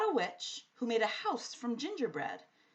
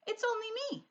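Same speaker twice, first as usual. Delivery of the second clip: put-on little girl; 13 semitones higher, faster than usual. A narrator's reading. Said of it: "said Sophie".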